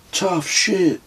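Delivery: put-on high boyish voice, impressed.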